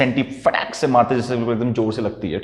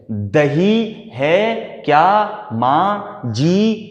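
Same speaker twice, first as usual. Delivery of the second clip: very slow, sugary coaxing ask; butter up the old neighbour; sweet-talking request; much slower than usual. Retold or imitated. Mother coaxing the old neighbour for curd, sugary. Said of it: "कितना मक्खन लगा रही".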